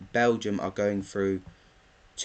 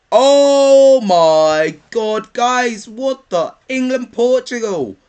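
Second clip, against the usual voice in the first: loud, high, drawn-out disbelief; stunned shouting; 12 semitones higher, 12 dB louder, slower than usual. Real, not acted. Fan seeing England draw Portugal, shouting in real shock. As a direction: cry out in shock at a huge fixture.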